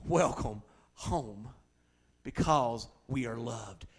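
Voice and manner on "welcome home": slow tender welcome. Shown as a demonstration. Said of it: "That's what we're gonna hear".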